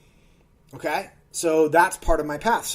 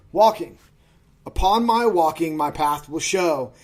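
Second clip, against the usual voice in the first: poem reading; 5 dB louder than usual. Acted. Reads his own poem aloud.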